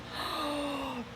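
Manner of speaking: sharp surprised intake of breath; startled gasp. Real, not acted. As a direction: gasp at a sudden crack. Shoulder cracked, startled.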